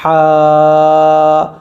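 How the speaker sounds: ہا breathed from the throat and held long; throat sound demo. Shown as a demonstration. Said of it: "اس ہا کی آواز جو ہے یہ حلق سے نکلتی ہے".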